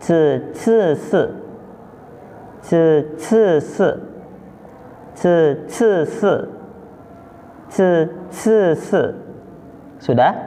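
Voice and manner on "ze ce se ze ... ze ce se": "Ce, ce, se" as "tsuh, ts-huh, suh"; spaced repeats of "Ce, ce, se", middle one puffed; schwa-ending drill. Shown as a demonstration. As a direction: hiss each onset of "Ce, ce, se" into a schwa. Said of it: "selalu dibacanya e".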